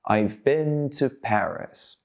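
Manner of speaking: slow, stretched-out model sentence; drawn-out, careful drill delivery; slowly. Shown as a demonstration. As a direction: say it slowly for learners to copy.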